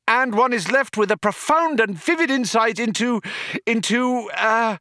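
high, grand climax that stalls; soar, then grope on the last word; crescendo giving out. A narrator's reading. Arthur's triumphant praise suddenly running out. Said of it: "which suddenly gave out on him".

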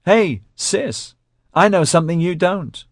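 put-on high, sing-out child's taunt; loud, high schoolboy teasing; teasing little brother. A narrator's reading. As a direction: needle your sister like a kid. Narrator voices boy teasing sister.

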